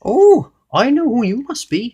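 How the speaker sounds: high, slow, knowing goblin. A narrator's reading. Goblin guessing who the stranger is, high little voice. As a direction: draw it out in a high goblin squeak. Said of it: "said the goblin".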